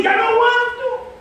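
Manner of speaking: high, stretched-out wail; acted cry of giving up; retold tearful cry. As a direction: wail it slowly, imitating a desperate prayer. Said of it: "en, en llanto".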